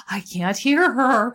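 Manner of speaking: straining to hear. Retold or imitated. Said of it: "I was like".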